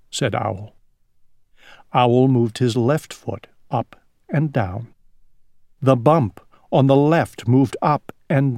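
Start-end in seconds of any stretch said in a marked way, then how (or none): none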